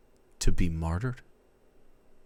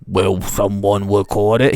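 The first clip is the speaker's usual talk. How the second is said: platypus voice; 11 dB louder than usual. Pretend. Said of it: "he's got a platypus mouth".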